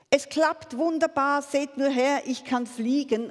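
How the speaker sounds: high, loud, jubilant call; excited calling out. A narrator's reading. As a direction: call it out triumphantly for everyone to see. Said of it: "rief er".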